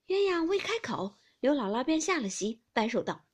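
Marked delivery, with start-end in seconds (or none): none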